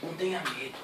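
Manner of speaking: gentle prophecy. Retold or imitated.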